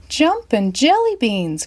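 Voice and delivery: put-on high, slow boyish exclamation; excited little boy voice; boy marveling at the bumpy ride; Billy cries out. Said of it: "cried Billy McNilly".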